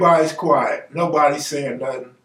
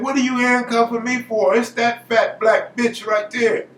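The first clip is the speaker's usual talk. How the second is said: higher-pitched mock outrage; indignant protest; 6 semitones higher than usual. Man protesting to sheriffs as he is handcuffed, indignant protest. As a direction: protest in a raised, insistent voice.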